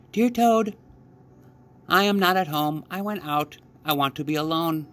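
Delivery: high frog voice reading a note; Frog's note voice. Acted.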